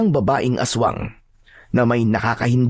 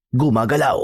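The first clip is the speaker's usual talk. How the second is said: usual speaking voice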